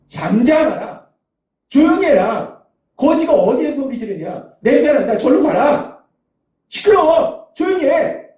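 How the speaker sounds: acted crowd rebuke, rising in pitch to a shout; scolding. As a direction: shush and shoo away a noisy beggar. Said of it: "그를 꾸짖어".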